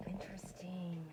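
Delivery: soft intrigued whisper with falling end. Real, not acted. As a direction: breathe the word out, intrigued. Whispered then repeated aloud.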